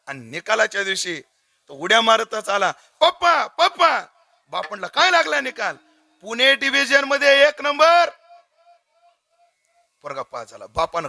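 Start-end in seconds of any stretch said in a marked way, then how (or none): 3.0-4.0 high, loud, drawn-out call to dad; a boy bursting in with big news; excited calling. Acted. Son calling his father about the results, high and excited. 4.9-8.1 excited announcement. Acted. Father asks, son announces results.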